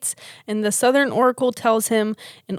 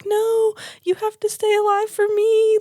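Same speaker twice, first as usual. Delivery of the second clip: pleading Atreyu; 11 semitones higher than usual. Retold or imitated. Atreyu pleading with dying horse.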